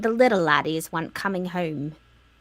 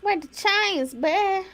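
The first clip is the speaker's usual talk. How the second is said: high Southern holler; mock country twang; 11 semitones higher, slower than usual. Teasing the friends in a high country twang. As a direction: call it out like a country boy.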